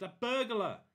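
loud, high alarmed shout. Acted. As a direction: yell the alarm at the intruder.

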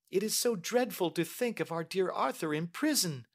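put-on high, pleading young lady; Mary. Niece begging her uncle to drop the matter, pleading. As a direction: beg earnestly in a high-pitched voice.

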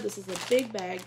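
usual speaking voice

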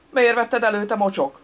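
loud, high angry roar; bellowed. A narrator's reading. Man with crowbar accusing the stranger, bellowing. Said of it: "bömbölte a pajszaros".

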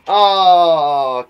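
long, loud, drawn-out "aww"; gushing coo. Acted. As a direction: melt loudly over something adorable. Cooing at cuddles in comic.

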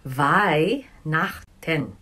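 Weihnachten said very slowly, VINE-nakh-ten; slow German demo. Shown as a demonstration. Teacher modeling Weihnachten for Filipino learners, very slow. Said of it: "Christmas is called Weihnachten".

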